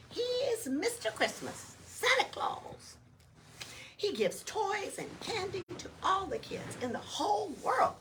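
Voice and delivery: high, chirpy little elf voice; cheerful elf. Acted. Elf explaining to Oscar, high and bouncy. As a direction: introduce yourself like a perky elf.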